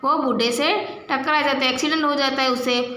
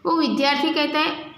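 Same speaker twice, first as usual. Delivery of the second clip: ordinary talk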